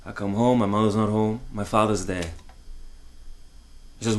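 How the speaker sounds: usual speaking voice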